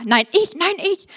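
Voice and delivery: kindischer Streit. Acted. Jünger streiten, wer der Größte.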